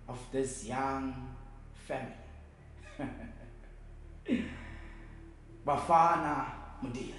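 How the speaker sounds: low, soft, mournful address; name the dead softly, weighed down by grief; subdued funeral tribute; grieving eulogy. Acted. Eulogy over murdered family.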